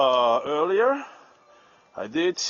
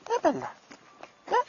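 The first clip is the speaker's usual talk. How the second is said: calling the dog; 13 semitones higher, much faster than usual. Real, not acted.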